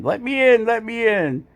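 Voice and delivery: squeaky little dog begging at the door; voicing the dog. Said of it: "She's barking, saying".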